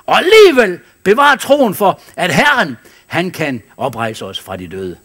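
high, loud preaching cry. Acted. Pastor exhorting the congregation, loud high preaching.

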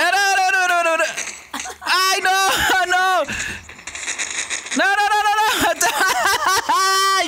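high, frantic string of refusals; panicked cries. Real, not acted. Player under fire cries no, panicked cries. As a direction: cry out in high-pitched panic.

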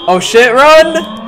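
high, slow frightened yell; panicking gamer. Real, not acted. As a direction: scream it as you flee. Chased by game monster.

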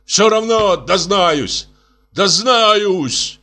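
high, slow, all-out yell; yelling with all his might. A narrator's reading. Костя yells from the kitchen with all his might. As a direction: bellow it from the next room. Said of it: "заорал оттуда, что было силы".

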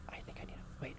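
hushed, breathy whisper. Real, not acted. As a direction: whisper as if someone might hear. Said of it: "I'm, like, whispering".

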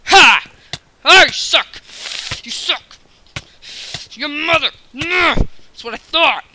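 loud, high jeering yell; gloating shout. Real, not acted. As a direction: rub the win in his face. Gloating after a kill.

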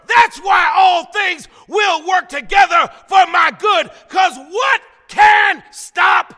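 loud, high-pitched, driving sermon climax; full-force preaching. Real, not acted. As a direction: preach it out, building to a shouted peak.

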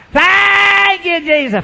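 high, loud, drawn-out wail; same crying monotone. Retold or imitated. Lady changing her words, still mock wailing. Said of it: "Same old monotone".

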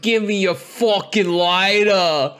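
mock Aussie quote. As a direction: ham up the cartoon bogan demand. Mimicking cartoon bogan line.

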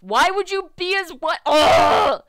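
high, very loud, ending in a groan; exasperated outburst. Acted. Listener fed up with the couple, exasperated yell. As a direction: yell the question, then a big groan.

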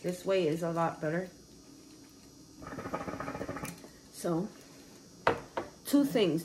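sipping between words; talking with straw at lips. Real, not acted. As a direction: lips around drinking straw. Seen 2: Right person holds straw at lips with cup; left person smoking.

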